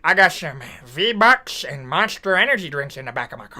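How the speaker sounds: Herbert the pervert. Acted. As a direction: lure the kid in, hushed and creepy. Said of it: "that's a bad accent".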